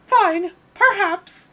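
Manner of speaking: high falsetto princess complaining. Acted. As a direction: squeal like a spoiled princess. Peach voice.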